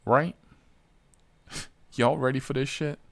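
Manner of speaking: ordinary delivery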